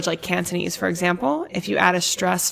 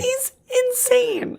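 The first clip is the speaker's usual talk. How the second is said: excited; slower than usual. Real, not acted. Both react to Robbie's stare.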